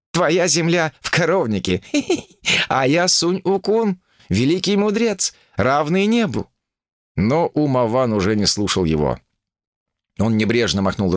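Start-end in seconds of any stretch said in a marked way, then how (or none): 0.1-6.5 higher, laughing proud boast; boastful monkey king. A narrator's reading. Sun Wukong boasts.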